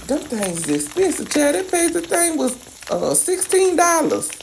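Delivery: high-pitched indignant outrage; outraged at price. Real, not acted. Cook complains to viewers about crawfish cost, high indignant squeal.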